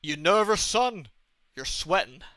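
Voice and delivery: lightly voiced suspicious American official; imitated officer. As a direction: play an officer questioning a traveller. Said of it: "he said, and I quote".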